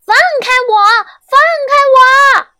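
very high, loud squealing yell; shouting. A narrator's reading. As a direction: kick and scream to be freed. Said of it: "大声嚷嚷". (小蚱蜢尖声大喊放开我)

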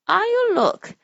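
put-on high, sweet old lady voice; a squeaky little old woman. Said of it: "said the little old woman".

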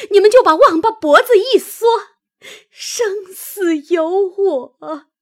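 high, loud outburst slowing into crying; tearful fury. A narrator's reading. Maid abandoned by her family, furious then crying. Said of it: "一面说，一面哭".

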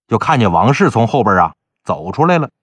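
ordinary delivery

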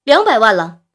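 loud auction call, pitch leaping at the end; excited auctioneer. A narrator's reading. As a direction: work up the room excitedly. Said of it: "兴奋地说".

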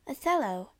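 ordinary delivery

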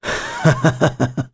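soft breathy scoffing laugh; a quiet, contemptuous huff of a laugh; scornful chuckle. Acted. Winded old man scoffs.

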